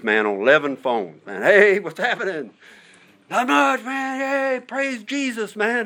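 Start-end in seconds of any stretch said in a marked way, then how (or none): 1.4-2.5 much higher, hearty hellos called down the row; excited greeting. Retold or imitated. Greeting inmates on phones. 3.3-5.9 a hint of a pumped-up inmate, much higher; fired-up inmate reply.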